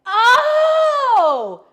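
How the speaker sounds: long, high, loud "oh"; big comic shock of recognition; sudden realization. Retold or imitated. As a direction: holler a drawn-out oh as it dawns. Acts out the moment of realization.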